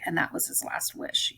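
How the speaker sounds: hushed, grim whisper; whispered aside about a death wish. Real, not acted. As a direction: drop to a whisper for the dark part. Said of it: "whispered grim aside".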